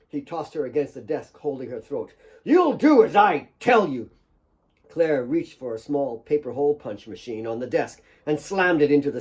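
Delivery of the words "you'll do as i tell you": higher, louder menacing command; menacing order. Acted. Nick holding Claire's throat, loud and menacing. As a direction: force the order on her.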